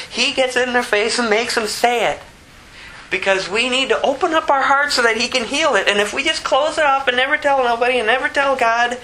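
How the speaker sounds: high-pitched, pressing preacher; impassioned preaching. Real, not acted. Preaches with rising force.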